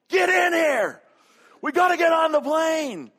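high, full-volume frantic holler; yelling. Retold or imitated. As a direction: yell at your wife to hurry, hammed up. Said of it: "he's yelling at his wife".

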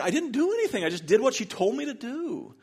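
put-on high, whimpering child's protest; mock tearful protest. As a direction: plead innocence to mom in a hammed-up crying voice. Crying boy defends himself to mother.

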